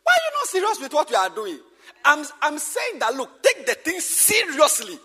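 loud, urgent rebuke of careless pastors; forceful preaching. Real, not acted. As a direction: hammer it home, raising the voice. Preacher urging pastors to be serious.

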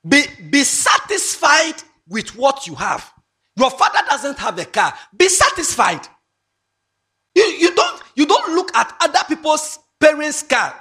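high, loud and insistent; loud, driving lecture. Real, not acted. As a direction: scold them like a stern parent. Lecturing girls about envy.

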